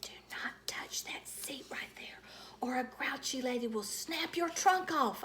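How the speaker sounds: hushed whisper. Acted. Junie B whispering a warning to her toy elephant. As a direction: warn the toy in secret. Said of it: "I whispered in his soft ear".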